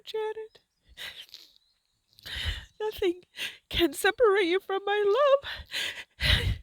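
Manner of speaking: a loving father's tearful invitation; tearful voice of God. Acted. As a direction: call the lost children home through tears. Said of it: "He's very emotional".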